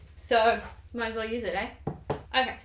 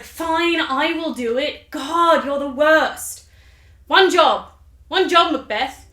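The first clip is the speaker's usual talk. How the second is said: loud, higher, drawn-out mock exasperation; exasperated Lady Macbeth; 4 semitones higher, 8 dB louder, slower than usual. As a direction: scold your useless husband, fed up. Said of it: "So Lady Macbeth's like".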